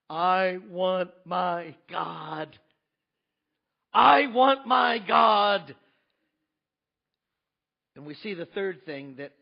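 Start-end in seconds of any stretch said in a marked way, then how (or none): 0.1-2.6 slow aching plea. Acted. Build to cried plea. 3.9-5.8 I want my God, shouted slowly and much louder; anguished shout. Acted. Habakkuk crying out to God in pain, shouting. Build to cried plea.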